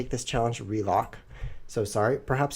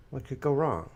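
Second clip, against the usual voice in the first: ironic; 3 semitones higher than usual. Acted. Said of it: "repeated ironic question".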